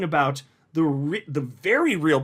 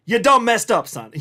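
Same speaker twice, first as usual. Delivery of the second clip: much louder and higher, playful street swagger; gloating taunt; 6 semitones higher, 8 dB louder than usual. Real, not acted. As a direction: rub it in their faces. Taunting XRP doubters.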